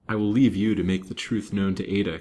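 plain reading voice

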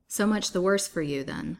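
usual speaking voice

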